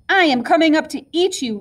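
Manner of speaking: a storybook troll threat, lightly roared; mild troll roar. A narrator's reading. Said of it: "roared the troll".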